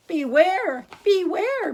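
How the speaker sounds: loud, high call of warning; calling beware. A narrator's reading. Bees call a warning to the bear, loud. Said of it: "called the bees to the bear".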